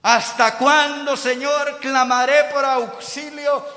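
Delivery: high, drawn-out cry to God; Habacuc's loud complaint. Acted. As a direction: shout the grievance slowly, building in force. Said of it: "Habacuc se queja por la injusticia".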